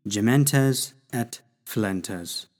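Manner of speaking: "Gementes et filentes" spoken slowly, every syllable given clearly; slow Latin demo. Shown as a demonstration. Teacher sounding out new Latin words slowly for students. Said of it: "To groan and to weep".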